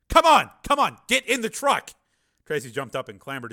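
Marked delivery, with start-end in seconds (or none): 0.1-1.9 high, loud urgent command; urgent shout. A narrator's reading. Man calling Tracy to the truck, urgent shout. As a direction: yell to hurry someone under fire. Urging someone into truck under fire.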